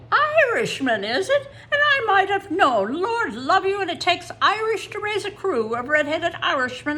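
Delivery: high, loud, laughing Irish brogue; jolly Irish accent, laughing at first; Irish toll keeper. A narrator's reading. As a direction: play the delighted Irish toll man. Toll man's Irish reply.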